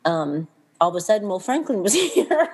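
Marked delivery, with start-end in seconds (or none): 1.3-2.5 laughing. Real, not acted. Surprise baby announced, laughing.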